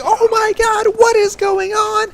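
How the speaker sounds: very high falsetto shriek; mock a horrified teacher walking in; shrill-pitched, drawn-out alarm, hammed up; shocked teacher impression.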